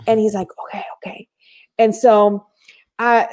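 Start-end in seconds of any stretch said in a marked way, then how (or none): 0.7-1.3 hushed agreement. Retold or imitated. Friend agreeing quietly.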